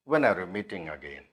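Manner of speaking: low, soft question dropping away on "again"; falling tone. Shown as a demonstration. Teacher models a question-word question, low falling tone on "again". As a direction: let "again" sink to a low close. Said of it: "the falling tone".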